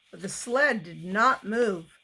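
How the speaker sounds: plain reading voice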